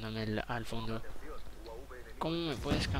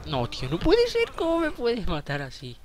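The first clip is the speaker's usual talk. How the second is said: outraged whine; 7 semitones higher, 5 dB louder than usual. Real, not acted. Killed, outraged squeal.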